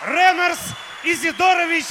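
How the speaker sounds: loud, high shouted name; ringmaster. Acted. Hosts announce star to crowd.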